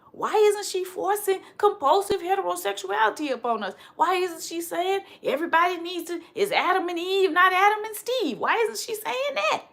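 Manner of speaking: lampoon the scandalized critic, pitch climbing to a laugh; mock critics. Mocking critics of her views on sexuality, high mock outrage breaking into a laugh.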